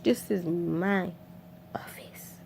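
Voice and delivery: slow whisper fading away; retold threat, whispered slowly; hushed warning. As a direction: drop to a drawn-out, menacing whisper. Acted boss telling intruder off.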